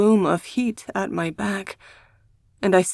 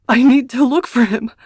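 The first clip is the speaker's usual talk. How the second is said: higher and louder, breaking into a sob; desperate plea that cracks into a sob; ending on a sob; 5 semitones higher, 7 dB louder than usual. A narrator's reading. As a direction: cry it out, voice breaking at the end. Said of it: "I end the words on a sob".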